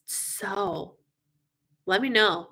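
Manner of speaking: usual speaking voice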